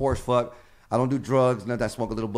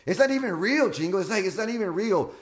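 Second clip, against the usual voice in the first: excited; 9 semitones higher than usual. Real, not acted. Excited intro.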